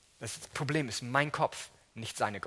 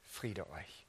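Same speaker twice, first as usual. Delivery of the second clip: much softer and lower, hushed; Jesus greeting frightened disciples, hushed; soft blessing; 8 semitones lower, 12 dB quieter than usual. Acted. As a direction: breathe peace into the room.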